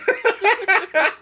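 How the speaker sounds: squealing laugh at own pun; real delighted laugh; high burst of laughter. Real, not acted. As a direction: break into a high-pitched laugh.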